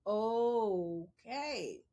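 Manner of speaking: long drawn-out, low okay; low, slow mock compliance; hesitant acceptance. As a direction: drag it out as it sinks in. Reluctantly accepting mother's advice.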